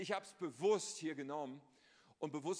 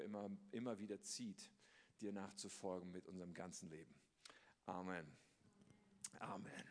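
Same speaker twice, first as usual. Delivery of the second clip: softer, lower, intimate prayer voice; 5 semitones lower, 5 dB quieter than usual. Acted. Pastor praying before the sermon, hushed and devout. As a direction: pray quietly, as if speaking only to God.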